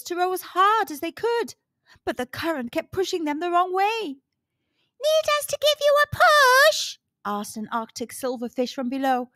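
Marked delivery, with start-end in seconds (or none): 5.0-7.0 high, quick little fish voice; tiny fish voice. Acted. Silverfish offering a push from below, high and quick. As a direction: chirp it like a small eager fish.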